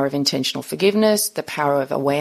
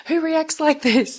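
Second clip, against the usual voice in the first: amazed doctor; 12 semitones higher than usual. Retold or imitated. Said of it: "the look on the doctor's face was like".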